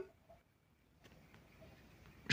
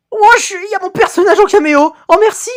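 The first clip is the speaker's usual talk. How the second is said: excited anime fan; 8 semitones higher, faster than usual. Real, not acted. Sees own character cameo.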